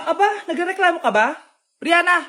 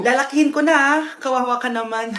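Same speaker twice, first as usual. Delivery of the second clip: normal talking voice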